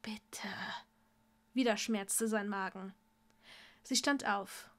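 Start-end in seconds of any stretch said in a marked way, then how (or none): 0.0-0.5 whispered plea; pleading whisper. Acted. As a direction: beg in a barely audible whisper. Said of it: "er erwiderte flehend den Blick".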